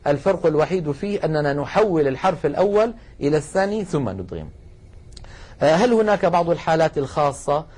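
ordinary delivery

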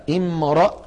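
"امرأ" as "imra'", "a" after the r; first form, fatha. Shown as a demonstration. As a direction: sound out "امرأ" with ra opened by fatha. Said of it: "الأولى". (نطق «امرأ» بفتح الراء)

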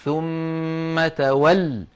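ثم تول slowly chanted, stopped on a pressed double l; long-held nasal m in ثم, firm final lam in تول; pressed doubled lam. Shown as a demonstration. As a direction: halt on تول, leaning into the shadda on its lam. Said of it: "ولا بد من الضغط على اللام المشددة".

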